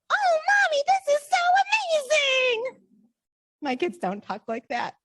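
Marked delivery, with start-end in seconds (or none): 0.1-2.8 put-on much higher, louder, slow and starry-eyed child voice; a mother voicing her dream child, gushing and high; gushing little child. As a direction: play an adoring kid opening a card. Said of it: "my children being like".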